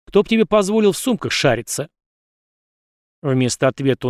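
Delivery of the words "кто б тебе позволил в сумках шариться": higher, rising anger; angry. A narrator's reading. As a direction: snap it back, losing patience. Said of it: "Я начал злиться".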